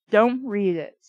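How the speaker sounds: plain reading voice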